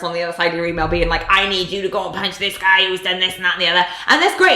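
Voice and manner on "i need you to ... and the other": put-on a light Welsh accent, brisk and quick; lightly voiced Welsh gruff character. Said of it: "it was in a Welsh accent".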